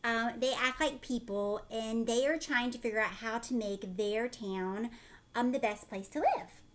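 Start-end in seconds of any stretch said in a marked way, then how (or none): none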